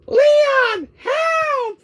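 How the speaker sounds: very high, loud cry; Ashley crying for help. Acted. Ashley screaming to Leon for rescue, very high. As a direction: scream for Leon like a panicked girl.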